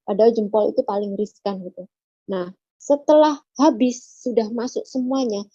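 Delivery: usual speaking voice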